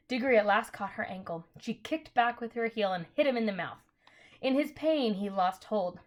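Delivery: plain reading voice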